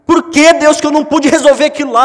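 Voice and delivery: high, loud lament to God; angry anguished prayer. Acted. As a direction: hurl the questions at God in grief. Acted angry sad prayer. (lamento irado a Deus, alto e agudo)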